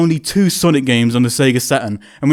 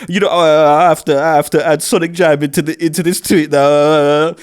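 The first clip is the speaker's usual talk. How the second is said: mock crying. Fan changing his tweet in tears, mock crying. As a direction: drag the words out as if blubbing while typing. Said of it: "now I'm just crying".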